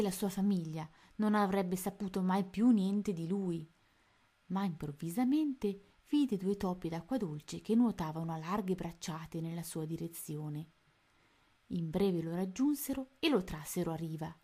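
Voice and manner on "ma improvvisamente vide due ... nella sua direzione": ordinary delivery, nothing marked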